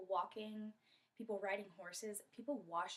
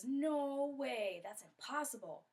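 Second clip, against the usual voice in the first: drawn-out, louder, higher refusal; disbelieving protest; 5 semitones higher, 6 dB louder, slower than usual. Acted. Friend to Kondoma about the vine, disbelieving protest. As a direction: balk at a crazy idea. Friend balks at risky swing.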